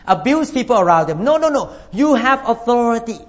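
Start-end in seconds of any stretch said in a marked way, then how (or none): none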